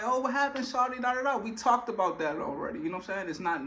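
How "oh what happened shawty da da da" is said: mock nosy question. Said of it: "I ain't gonna bring it up like".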